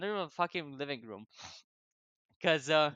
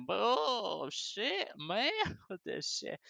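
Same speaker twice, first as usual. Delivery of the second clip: put-on drunk rambling; 6 semitones higher, much slower than usual. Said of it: "like a fucking lunatic".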